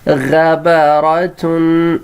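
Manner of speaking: غبرتن drawn out syllable by syllable, ghain-ba-ra-tun; slow model reading. Shown as a demonstration. Teacher to pupils, modelling the word slowly. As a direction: sound out غبرتن slowly for the class. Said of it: "اس کو پڑھا جائے گا".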